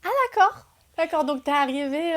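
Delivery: un « ah d'accord » qui monte très haut, puis on se reprend; vraie surprise. Real, not acted. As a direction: surprise sincère qui éclate, plus forte et plus aiguë. Réaction à l'histoire surprenante. (elle découvre la scène, vraiment surprise)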